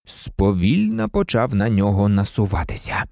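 ordinary delivery, nothing marked

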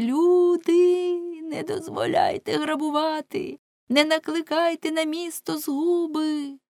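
frantic yelling. Acted. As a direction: shout like a man beside himself. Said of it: "кричав з Амвона, мов несамовитий". (Уленшпігель несамовито кричить до людей)

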